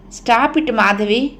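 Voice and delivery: usual speaking voice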